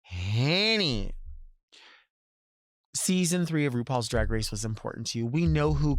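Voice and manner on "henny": "Henny" with an eh vowel, slowly arched; long eh-vowel "Henny"; henny pronunciation. Shown as a demonstration. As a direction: draw out "Henny" with a rise and fall. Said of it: "Now, if you say".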